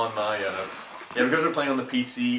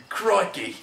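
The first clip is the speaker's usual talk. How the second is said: badly faked Australian "crikey", pitched higher; hammed-up mock-Aussie exclamation; bad Australian accent; 8 semitones higher than usual. American mocking Australian friend, bad Aussie accent. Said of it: "You are so bad at that".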